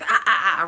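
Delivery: sharp scolding ah ah ah; clipped warning sounds; mock angry ah ah ah. Shown as a demonstration. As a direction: bark it out like a cross parent. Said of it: "we're like".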